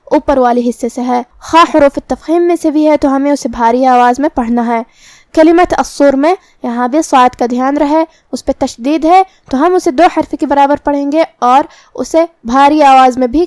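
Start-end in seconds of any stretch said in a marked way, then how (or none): none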